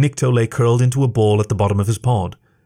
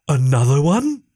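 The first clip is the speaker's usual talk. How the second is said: surprised giant; 4 semitones higher than usual. A narrator's reading. Giant finding a second tiny creature, rising wonder. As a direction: let it climb in giant wonder.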